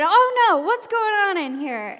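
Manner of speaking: high, loud alarmed demand; exclaiming rescuer. Retold or imitated. Mister Jones walking into a classroom fight, loud exclamation. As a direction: burst in and demand an explanation.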